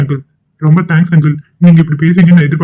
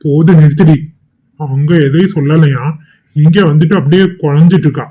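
ordinary talk

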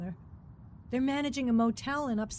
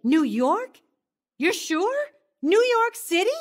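shocked disbelief; 9 semitones higher, 7 dB louder than usual. Acted. Shocked to learn husband's destination.

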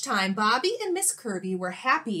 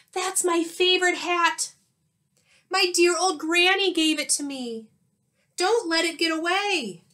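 higher, louder alarmed cry; panicked teacher; 8 semitones higher, 5 dB louder than usual. Acted. Teacher crying out to Bobby as her hat blows away, alarmed. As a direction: plead urgently as the hat flies off.

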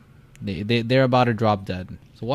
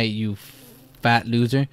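normal talking voice

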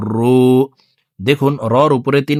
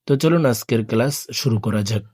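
ordinary talk, nothing marked

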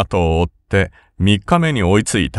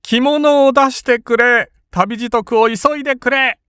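panicked shriek. A narrator's reading. Rokubei, ears covered, shrieks for his travel clothes in panic. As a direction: shriek it out in terror. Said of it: "悲鳴を上げるような声で".

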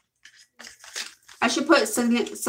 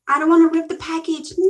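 fretting; 5 semitones higher, 5 dB louder, much faster than usual. Real, not acted. Worried while opening package.

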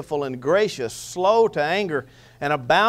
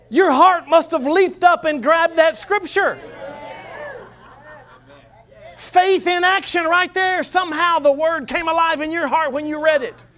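fervent preaching; 10 semitones higher, 4 dB louder than usual. Real, not acted. Preacher exhorts the congregation about faith, fervent and raised. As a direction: preach it with rising fervour.

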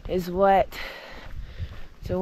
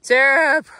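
calling out; 6 semitones higher, 10 dB louder than usual. Real, not acted. Spotting a friend on trail.

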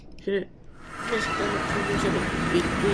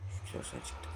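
breathy whispered relief, barely voiced; relieved whisper; 14 dB quieter than usual. Real, not acted. Relieved after escaping.

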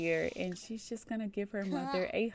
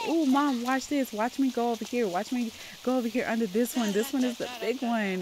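put-on higher, eager kid showing off; excited child; 4 semitones higher than usual. Child to mom at the splash pad, excited. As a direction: play a child begging for Mom's attention.

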